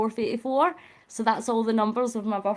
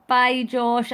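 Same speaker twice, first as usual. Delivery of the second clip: normal talking voice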